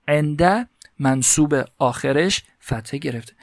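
ordinary talk, nothing marked

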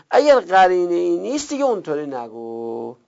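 ordinary delivery

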